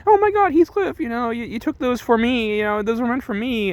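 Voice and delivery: high, swooning gush; high breathless mock swoon; gushing girl voice. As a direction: gush like a dramatic heroine. Kathy gushing over Heathcliff.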